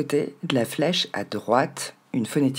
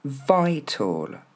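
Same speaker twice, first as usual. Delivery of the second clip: VITAL as "VY-tol", high then falling; first-syllable stress; 3 semitones higher than usual. Shown as a demonstration. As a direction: punch the VY of "vital". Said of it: "Marquez bien l'accent tonique sur la première".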